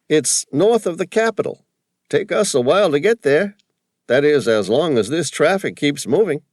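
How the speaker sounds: high, loud broad East Coast cabbie voice. A narrator's reading. Cabbie calling to Sam from the taxi, broad East Coast accent. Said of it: "his fake accent".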